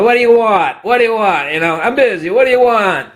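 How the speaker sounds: high, curt impatient "what do you want"; rude busy clerk. Retold or imitated. Old B and H clerk answering a call, mock impatient and curt. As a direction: bark it like a swamped clerk. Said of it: "It used to be you call 'em".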